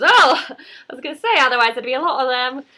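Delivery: high, loud comic British woman; British accent. Retold or imitated. Mandy replying to the wise men, loud high British accent. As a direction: exclaim it in hammed-up high British. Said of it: "in a British accent".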